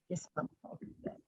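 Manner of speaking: quick, very soft, low reply; mock-obedient, under the breath and hurried; meek compliance. Told to get over themselves, meek compliance.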